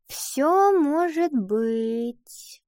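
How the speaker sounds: long, teasing maybe; noncommittal drawl. A narrator's reading. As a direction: draw every word out vaguely. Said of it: "неопределённо протянула Ива".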